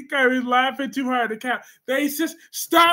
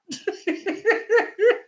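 high laugh; 10 semitones higher than usual. Real, not acted. Laughs at own grandma voice.

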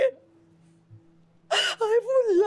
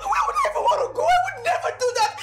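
pleading distress; 3 semitones higher than usual. Acted. Maid begs her employer through tears, high and pleading. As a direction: beg through tears, pitch climbing.

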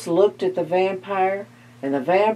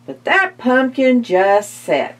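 ordinary delivery

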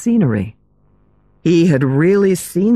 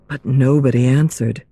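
plain reading voice

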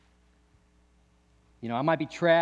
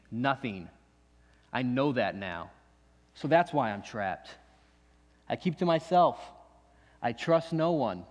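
usual speaking voice

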